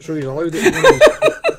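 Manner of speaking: laughing through the words. Real, not acted. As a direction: dissolve into laughter as you speak. Joking defence of Vic.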